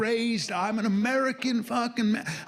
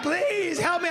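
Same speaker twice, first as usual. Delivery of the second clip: pitched-up mock girlfriend begging; high girly plea; 7 semitones higher than usual. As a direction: play her as a helpless damsel, voiced high. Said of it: "And she's like".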